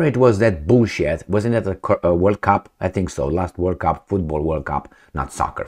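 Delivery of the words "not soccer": whispered aside. Real, not acted. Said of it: "aside correcting himself".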